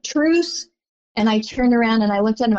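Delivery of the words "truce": lifted, rising "Truce." offered up high; Patrick's offer of truce. Retold or imitated. Patrick Ewing offers a courtside truce, rising, questioning. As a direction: ask "Truce." with a questioning upward lift. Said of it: "held his hand way up in the air and said".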